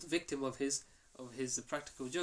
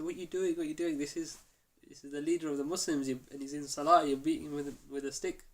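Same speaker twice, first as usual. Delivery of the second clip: alarmed calling out; 2 semitones higher, 7 dB louder than usual. Retold or imitated.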